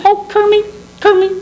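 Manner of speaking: very high cooing puppet voice; hammed-up lovesick puppet; puppet sweetheart impression. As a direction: call the beloved in falsetto. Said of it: "I'm gonna do the impression".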